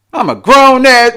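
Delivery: lightly mock her brash way of talking.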